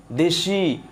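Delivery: দেশি said with a long, sustained final vowel; drawn-out open syllable. Shown as a demonstration. Teacher repeating the example for students, stretching the end of দেশি. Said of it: "এই শব্দগুলো আপনি টেনে বড় করতে পারবেন".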